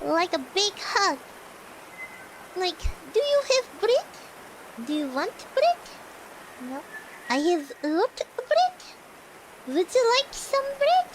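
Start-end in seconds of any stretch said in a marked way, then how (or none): 3.1-11.1 put-on Russian accent, questions swinging upward; affectionate mock Russian accent; imitation Russian accent. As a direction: lightly imitate a Russian speaker. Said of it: "you can say anything in Russian".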